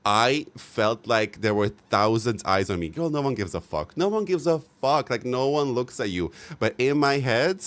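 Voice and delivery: normal talking voice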